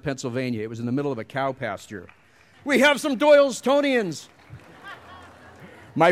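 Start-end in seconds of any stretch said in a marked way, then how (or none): 2.6-4.3 high, delighted call to the crowd. Real, not acted. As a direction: call out to hometown fans, pitched way up. Reacts to cheering in the audience.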